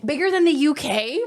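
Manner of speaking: incredulous rising question; disbelief, voice lifting on "the"; surprised. Real, not acted.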